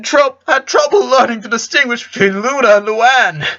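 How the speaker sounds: high-pitched, amused storytelling on the edge of laughter; tickled, fighting laughter. A narrator's reading. Father telling his son how he teased little Lori, tickled. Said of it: "had to stop for a few seconds so he could laugh".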